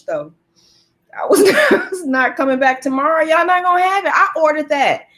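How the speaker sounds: normal talking voice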